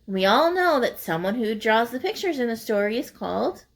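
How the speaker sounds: usual speaking voice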